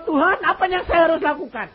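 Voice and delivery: loud, high plea to God; loud, high pleading prayer; desperate prayer. Retold or imitated. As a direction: cry out to heaven for help. Crying out in prayer.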